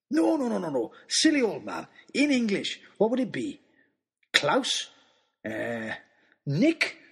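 put-on jovial old man searching for the English word; playful self-correcting giant; jolly giant fumbling. Christmas man searching for his name.

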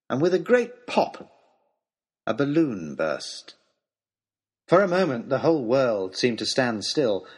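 usual speaking voice

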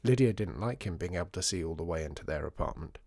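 ordinary delivery

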